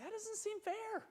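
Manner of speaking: high, slow, soft whiny complaint; mock whine. Colonists complaining to the crown, mock whiny. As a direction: pout it like a put-upon child.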